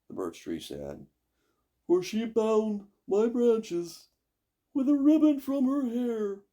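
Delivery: high slow airy tree voice; birch tree voice. Acted. Birch tree answering the witch.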